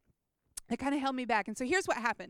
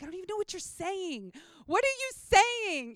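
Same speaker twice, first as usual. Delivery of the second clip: baffled protest; 7 semitones higher than usual. Retold or imitated. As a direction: stretch it out high, utterly lost. Baffled reply to prophetic word.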